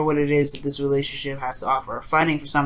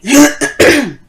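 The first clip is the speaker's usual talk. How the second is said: loud, high hacking throat clear; phlegmy throat clearing; 10 semitones higher, 10 dB louder than usual. Real, not acted. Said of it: "there's something in my throat".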